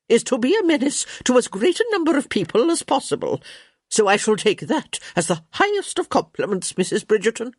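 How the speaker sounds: high, brisk, grandly declaring countess; crotchety countess. A narrator's reading. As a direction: relish it as an imperious elderly countess.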